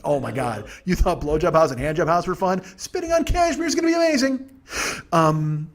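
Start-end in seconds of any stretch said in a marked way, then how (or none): 2.8-4.5 high-pitched gleeful squeal of excitement; mock hype. Comic hyping an imaginary club, high mock excitement.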